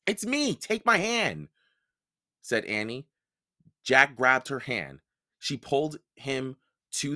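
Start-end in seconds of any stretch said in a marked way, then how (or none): 0.1-1.5 put-on high girl voice. Annie reassuring Jack.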